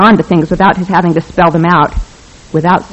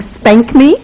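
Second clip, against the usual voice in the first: Spank me as a raised, mock-shocked question; mock yell; 5 semitones higher than usual. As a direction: quote the words with a rising incredulous lift.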